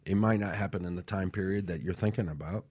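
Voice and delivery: normal talking voice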